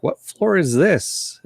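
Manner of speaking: high, slow puzzled question; puzzled Doc. Retold or imitated. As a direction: drag it out, lost and confused. Doc voiced, confused.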